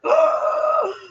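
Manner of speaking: sharp high gasp; a sudden high-pitched gasp. Retold or imitated. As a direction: suck in air as if burned. Startled by husband's heat.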